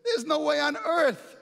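a city friend who can't believe it; incredulous friend. Acted. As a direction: scoff at the very idea, pitch jumping up. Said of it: "his friend says".

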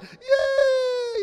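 high, loud squeal of joy; squeal of delight. Retold or imitated. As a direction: imitate a little girl squealing with delight. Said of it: "heard her scream in delight".

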